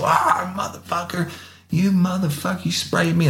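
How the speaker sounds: high-pitched yelp of a man hit with spray; mock pained yelling. Retold suspect screaming at the officer, high and yelping. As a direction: squeal it out like your eyes are burning. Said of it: "He's like".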